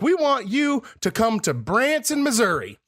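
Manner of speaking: slow, high-pitched Southern sales pitch; Southern salesman. Retold or imitated. Timeshare caller pitching Branson, Southern accent. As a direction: sell it big with a Southern accent. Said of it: "talking in a Southern accent".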